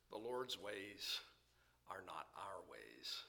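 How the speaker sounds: are not our ways, whispered slow; hushed whisper. Acted. Preacher quoting Isaiah to his congregation, whispered slowly. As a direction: breathe it out like a secret.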